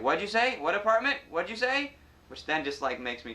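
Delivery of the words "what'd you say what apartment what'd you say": high, loud impatient "what'd you say"; mock impatient phone voice; impatient caller. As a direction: mimic a rushed delivery guy. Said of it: "They're like".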